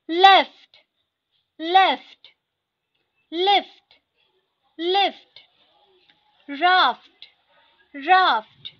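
each word said twice, loud and crisp; teacher drilling vocabulary, clear and loud; word drill reading. Shown as a demonstration. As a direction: read the list aloud for the class to repeat. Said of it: "मैं line by line ऐसे पढ़ते जाऊंगी".

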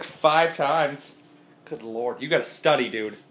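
usual speaking voice

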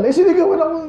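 a put-on child's complaint to the parent; whiny child. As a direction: whine like a kid playing victim.

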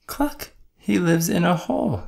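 high clucking hen noises, well above the narration. A narrator's reading. Hen rushing up to the truck, high clucking. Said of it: "said Hen".

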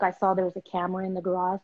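ordinary talk, nothing marked

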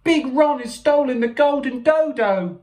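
high squeaky panicked voice; small frantic creature, very high; squeaked. A narrator's reading. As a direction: squeak out the alarm, pitched right up. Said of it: "Percival squeaked".

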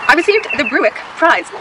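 Bruick slurred through braces. Real, not acted. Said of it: "my braces".

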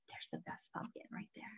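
hushed and quick; whispered aside. Real, not acted. Host points out the pumpkin to the kids, whispering. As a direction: whisper it like sharing a find.

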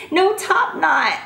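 usual speaking voice

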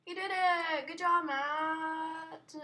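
high, loud, drawn-out praise; excited cheer. Real, not acted. Gamer cheering his friend Matt, excited cheer. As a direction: cheer him on, stretching the name.

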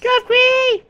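high squeal. Pretend. Friend repeating a silly catchphrase for laughs, high squeal. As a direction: squeal it far above your voice.

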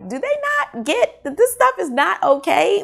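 high-pitched, exasperated question; incredulous reaction. Retold or imitated. Reader reacting to the book's fans, incredulous.

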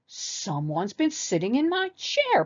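slow, indignant papa bear growl; storybook growl, drawn out. A narrator's reading. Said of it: "Papa Bear growled".

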